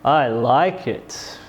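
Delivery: "I like it" slow and low, stretched out; drawn-out intonation. Shown as a demonstration. Teacher showing many ways to say it, drawn-out "I like it". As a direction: linger on each word of "I like it". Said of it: "say the following sentence many different ways".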